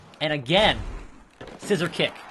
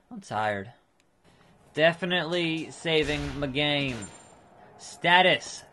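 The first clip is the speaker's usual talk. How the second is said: ordinary delivery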